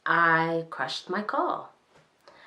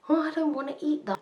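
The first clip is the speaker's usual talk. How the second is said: high, quick imitation of a fussy child; whiny little cousin; 11 semitones higher, faster than usual. Teller mimics little cousin turning down packed lunch, high-pitched.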